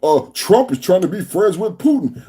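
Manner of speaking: low, mocking quote of the Democrats. Host parodies Democrats complaining about Trump's friendships, mocking. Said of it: "what the Democrats say".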